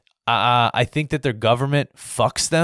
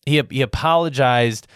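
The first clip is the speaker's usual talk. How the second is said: usual speaking voice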